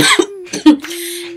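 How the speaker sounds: harsh dry cough fit. Real, not acted. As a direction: cough hard, really sick. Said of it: "I'm really sick".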